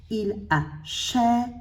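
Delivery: "achète" slow, as "a", "chè", "te"; split into syllables. Shown as a demonstration. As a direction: break "achète" apart, the final "te" on its own beat. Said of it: "Le T fait partie de l'autre syllabe".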